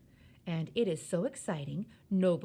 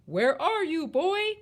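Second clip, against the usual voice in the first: high, loud searching shout; exasperated father's yell; 10 semitones higher, 8 dB louder, slower than usual. A narrator's reading. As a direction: bellow for the missing boy. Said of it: "and he shouts".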